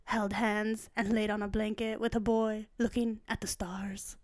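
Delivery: soft, breath-light mock Italian accent; hushed, lightly imitated Italian seducer. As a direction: murmur it like an Italian romantic lead. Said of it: "he's got an Italian accent".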